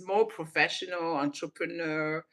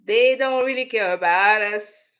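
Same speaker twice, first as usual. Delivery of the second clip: louder, higher belted line; quoting the lyric loudly; 5 semitones higher, 11 dB louder than usual. Retold or imitated. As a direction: belt the lyric out like a song hook. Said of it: "[name] been telling us".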